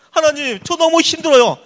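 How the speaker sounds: loud, high cry to God; desperate prayer. Acted. Young Joseph praying to God, desperate. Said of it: "하나님한테 기도했을 겁니다".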